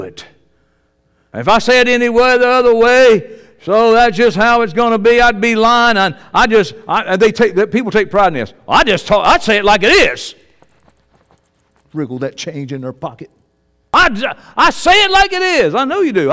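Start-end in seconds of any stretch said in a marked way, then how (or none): 1.3-7.9 loud, high-pitched sermon delivery; full-force preaching. Real, not acted. Preacher tells an unbeliever he is bold and confident, full-force preaching. 13.9-16.3 high, loud mock boast; boastful plain talker. Preacher mimics people who take pride in plain talk, mock boast.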